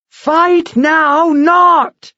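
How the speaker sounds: very high, drawn-out frustrated yell; scream. Acted. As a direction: scream the refusal slowly. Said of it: "Mara screamed".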